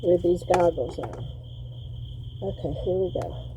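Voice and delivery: talking through a respirator mask; muffled behind a mask. Real, not acted. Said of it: "in this mask".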